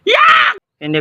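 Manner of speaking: sharp high yelp of disgust, then a shout. Acted. Rejecting the crush result with a disgusted shout. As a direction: scream it in disgust.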